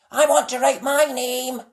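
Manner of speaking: high, loud puppy voice; eager puppy wanting a turn; Spot's eager voice. A narrator's reading. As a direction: Spot keen, up high. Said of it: "said Spot".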